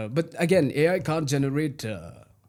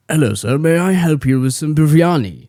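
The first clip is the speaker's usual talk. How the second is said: much louder, slow, formal hotel greeting; polite waiter voice; 3 semitones higher, 15 dB louder than usual. Pretend. To a British guest in Mangalore, a loud formal waiter voice. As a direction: play the eager waiter offering biryani. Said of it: "it would go something like this".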